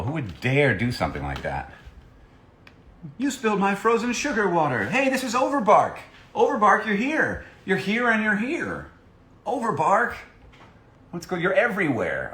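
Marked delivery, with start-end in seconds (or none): 3.2-12.3 excited. Real, not acted. Excited greeting of a cosplayer.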